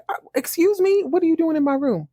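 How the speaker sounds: high-pitched, imperious "excuse me"; haughty Alexis. Retold or imitated. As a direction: play the unbothered diva caught red-handed. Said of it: "kinda like Alexis on Dynasty".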